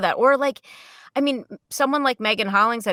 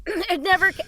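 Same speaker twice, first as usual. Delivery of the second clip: choking on something mid-sentence; words catch in a blocked throat; 9 semitones higher than usual. Real, not acted. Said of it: "I'm choking on something".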